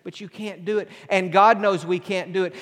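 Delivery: ordinary talk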